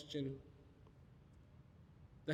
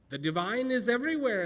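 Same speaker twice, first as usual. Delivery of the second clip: mock preacher; 6 semitones higher, 7 dB louder, faster than usual. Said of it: "People are told".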